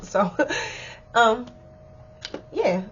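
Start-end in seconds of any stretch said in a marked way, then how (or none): none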